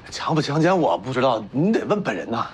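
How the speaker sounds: low-pitched, defensive disclaimer; sullen, low dodge; evasive denial. Acted. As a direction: pass the blame on in a low voice. Accused son dodges mother's question.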